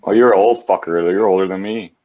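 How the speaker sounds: usual speaking voice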